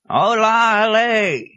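high, loud, drawn-out cry; ringing high hail; hermit's shout. A narrator's reading. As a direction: call it out at the top of the voice. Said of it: "he shouted".

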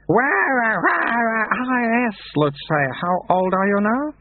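put-on high, babbling infant-old abbot voice; a wise old mind in a baby's mouth, slow. A narrator's reading. Baby abbot voiced in narration.

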